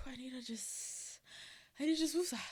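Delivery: a real sigh into a whispered woosah. Real, not acted. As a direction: breathe it out under your breath to settle down. Calming herself down after episode.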